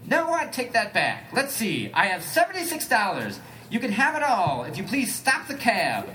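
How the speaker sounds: high, slow pleading bargain; put-on Elliot voice.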